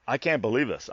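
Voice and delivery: ordinary talk, nothing marked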